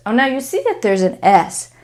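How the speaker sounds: ordinary talk, nothing marked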